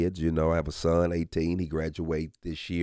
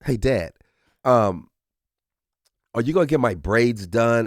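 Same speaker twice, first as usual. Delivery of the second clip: put-on lightly higher sweet kid asking dad; little girl voice; 5 semitones higher, 6 dB louder than usual. Daughter asking dad for braids, lightly childlike. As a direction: ask like a hopeful eight-year-old. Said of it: "so Charlie says to me".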